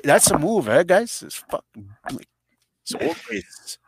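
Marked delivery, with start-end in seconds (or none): 1.2-3.8 soft unvoiced whispered aside. Real, not acted. Teller confiding to friends, whispered aside. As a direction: let the friends in on a secret.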